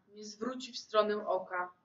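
plain reading voice

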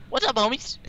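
high-pitched intro greeting; Sly Fox. Retold or imitated. Friend imitating a YouTuber's intro, high-voiced impression. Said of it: "Gotta be like Sly Fox".

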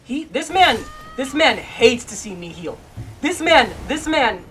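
loud, high outraged rant; exasperated shouting at a video game boss; furious yell. Real, not acted.

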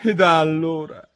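high, loud comic wail; whining high complaint; plaintive. A narrator's reading. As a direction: lament it as the aggrieved Hatter. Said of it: "continuò il cappellaio con voce lamentosa".

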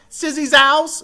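HOUSE bent into slangy "sizzouse", high and loud; imitating kids' slang. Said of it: "I think that's how kids say it".

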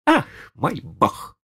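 high, exasperated lady; boiling over. Acted. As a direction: snap in high outrage. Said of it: "вскипела дама".

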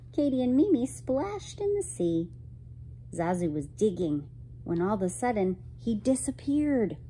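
usual speaking voice

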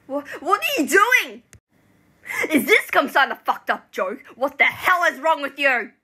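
outraged shouting in disbelief; furious outburst. Acted. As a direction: yell at your friend, appalled. Friend erupts at the confession.

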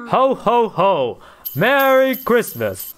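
slow booming ho ho ho; jolly Santa greeting. Acted.

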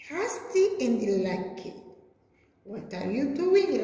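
ordinary talk

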